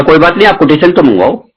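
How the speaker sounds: high-pitched eager salesman voice; pushy bank manager. Retold or imitated. Pushy bank manager brushing objections aside.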